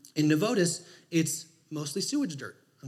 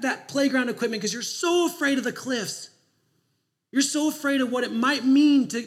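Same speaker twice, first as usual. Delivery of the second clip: higher, louder urgent sermon appeal; preaching at full force; 4 semitones higher, 4 dB louder than usual. Acted. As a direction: press the congregation to let go. Preaching exhortation to congregation.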